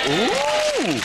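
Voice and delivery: a long high hammed-up ooh; high mock ooh. Host reveals the gallery of pictures, high hammed-up ooh. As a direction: tease the audience with a high ooh.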